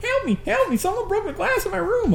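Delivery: put-on a scared little boy squealing; mimicking a panicked kid. Retelling the boy running upstairs, high frantic cries. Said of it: "He's like".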